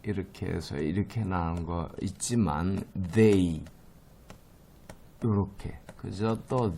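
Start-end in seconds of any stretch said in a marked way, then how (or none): 3.1-3.6 "they" with a voiced th; isolated "they", tongue-between-teeth th. Shown as a demonstration. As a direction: demonstrate the buzzing th in "they". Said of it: "이렇게 발음하는 것들은 요렇게 발음이".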